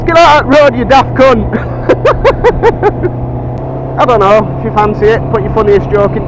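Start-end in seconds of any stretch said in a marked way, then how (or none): 0.0-1.5 high, loud yell out the window; shouted punchline. Acted. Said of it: "sticks her head out a window and goes". 1.6-4.0 long breathy high-pitched laugh. Real, not acted. Laughing at his own joke.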